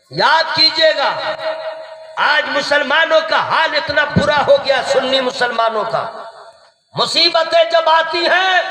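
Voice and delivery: high, forceful sermon delivery; fervent preaching. Real, not acted. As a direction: preach it out to the whole crowd.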